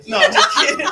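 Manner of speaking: loud laughing joke; laughing shout. Real, not acted. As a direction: tease through laughter. Joking plug, laughing.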